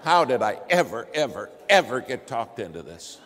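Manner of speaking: loud, exasperated wail of regret; exasperated outburst. Retold or imitated. Passenger stuck in a pilotless plane, loud exasperated outburst.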